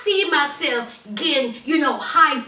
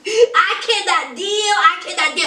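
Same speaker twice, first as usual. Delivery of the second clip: higher-pitched, hammed-up exasperation; mock overwhelmed outburst; 6 semitones higher, 3 dB louder than usual. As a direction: imitate someone at the end of their rope. Acts out overwhelmed boyfriend.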